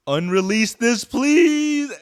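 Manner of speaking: high, loud begging cry; loud exasperated please; pleading shout. Real, not acted. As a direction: yell it like a desperate plea. Begging the song be pulled.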